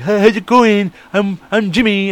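high-pitched goofy hello; over-the-top put-on character. Keeping up the act on meeting her, over-the-top put-on voice. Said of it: "I just kept saying that".

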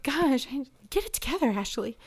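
scolding herself. Retold or imitated. Crying on the way home, lightly scolding herself. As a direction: snap at yourself to pull it together.

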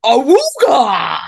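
slow, loud booming Awooga; ahooga horn. Shown as a demonstration. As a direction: bellow it like Fashanu. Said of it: "Go, go full Fashanu".

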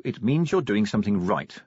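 usual speaking voice

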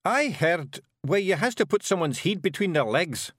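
high, tentative Scots suggestion; doubtfully. A narrator's reading. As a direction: offer it unsurely as a Feegle. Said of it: "said Rob doubtfully".

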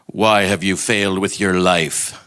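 slow, accusing God voice unlike his own; stern voice of God. Acted. God to a failed soul as many imagine him, sternly accusing. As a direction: demand it slowly, as a reproachful God. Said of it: "That's the voice of God for a lot of people".

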